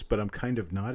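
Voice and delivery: ordinary talk, nothing marked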